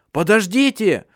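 high desperate call to stop; shouting. A narrator's reading. Redhead yelling after his comrades, high desperate shout. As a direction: shout after the fleeing men. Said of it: "закричал Рыжий".

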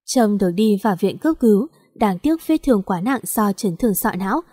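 ordinary delivery

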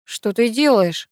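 slow, puzzled question; surprised. A narrator's reading. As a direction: ask slowly, taken aback. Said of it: "удивился Герман".

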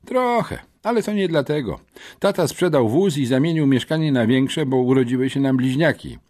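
put-on cheeky new kid; boy's voice. A narrator's reading. Boy explaining. (nowy chłopak, wysoko)

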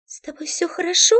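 put-on a young woman's concerned query from behind; girl's worried question. A narrator's reading. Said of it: "раздался голос сзади".

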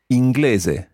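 inglese as eeng-GLEH-zeh, rounded Italian e; model pronunciation. Shown as a demonstration. Teacher repeats inglese for Katie to echo. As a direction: say inglese cleanly for her to copy. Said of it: "let's try that again".

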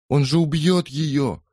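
high, slow, trembling plea; tearful stammer. Acted. Polly, trembling and tearful, pleads with the neighbours. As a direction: stammer it out through tears. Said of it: "лепетала дрожащая, зарёванная Полли".

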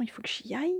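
hushed whisper, voiceless. Real, not acted. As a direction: whisper it as if someone is nearby. Wrapping up, whispering.

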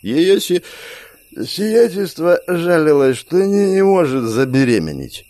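high, slow, stumbling slur; drunk, tripping over words; slurred drunk speech. Acted. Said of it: "совсем заплетающимся языком".

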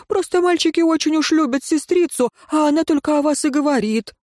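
high-pitched excuse-making character voice; high, pleading and placating; placating father. A narrator's reading. Отец оправдывает детей перед советником.

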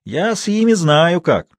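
rustic know-how savoured; with relish. A narrator's reading. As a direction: boast slowly, enjoying it. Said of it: "с удовольствием сказал Матвей".

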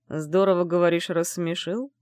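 slow, icy, distant repeat; cold and detached. Acted. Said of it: "Холодно и отстранённо повторил".

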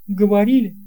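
usual speaking voice

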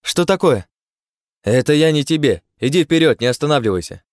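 calling out high and loud from afar; tense shout. A narrator's reading. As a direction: shout it tensely, straining to be heard. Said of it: "напряжённый крик Ирены".

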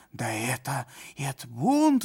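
shouting. A narrator's reading. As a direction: yell it's a mutiny. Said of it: "закричал исправник".

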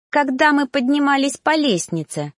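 high, teasing answer; sly. A narrator's reading. Narrator corners the reaper, slyly smiling. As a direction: answer with a crafty smile. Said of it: "коварно улыбнулась я".

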